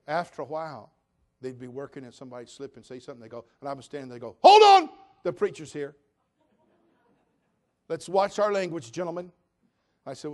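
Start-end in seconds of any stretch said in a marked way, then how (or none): 4.4-9.3 loud, raised-pitch mock announcement; imitating a workman hollering to the crew; calling out a warning. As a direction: shout the first words, then ease down a little. Workmen calling out warning as preacher appears.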